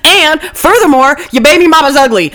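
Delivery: high, fast mock sassy woman; catty insult. Mocking a woman trading insults with her friend, sassy and high. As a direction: sass it out, high and quick.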